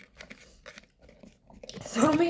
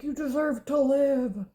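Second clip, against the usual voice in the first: solemn alien; 4 semitones higher, slower than usual. Pretend. Aliens pronouncing judgment slowly.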